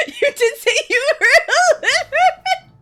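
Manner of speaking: high squealing laugh through the words; real giggling fit, voice cracking high; squeaky laughing; laughing hard. Real, not acted. Laughing at being called illiterate.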